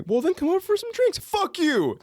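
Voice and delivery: high sneering mock voice; mocking neighbor. Imitating the rude neighbor's retort, high and sneering.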